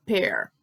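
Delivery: "Pear" with open eh, as in pé; correct vowel. Shown as a demonstration. Teacher recaps for learners, "Pear" rhyming with air. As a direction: keep "Pear" open, ending in English r. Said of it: "lembra da pronúncia de pé".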